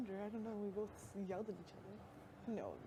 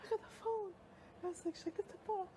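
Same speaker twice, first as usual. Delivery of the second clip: high crying voice; imitating a tearful mom; 9 semitones higher, faster than usual. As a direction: choke it out through tears. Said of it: "and she goes".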